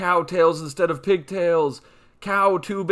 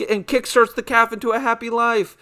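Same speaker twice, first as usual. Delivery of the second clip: ordinary delivery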